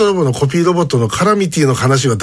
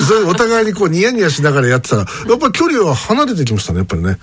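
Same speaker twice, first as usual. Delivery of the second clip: ordinary talk, nothing marked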